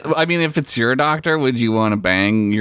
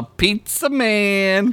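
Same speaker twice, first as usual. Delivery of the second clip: high, slow drawn-out Pizza Man; teasing the sender's name; mock-announcing the name; 9 semitones higher, much slower than usual.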